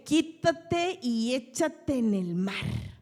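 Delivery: "Quítate y échate en el mar" slow and loud, each word drawn out; commanding the mountain. Shown as a demonstration. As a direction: order the mountain away, slowly. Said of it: "Donde tú dudes, no se echa al mar".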